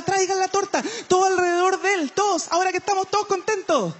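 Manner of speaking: high, bossy hostess ordering the guests; bossy party hostess. Retold or imitated. Stand-up imitating the hostess rounding up guests. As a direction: hostess hurrying everyone to the cake.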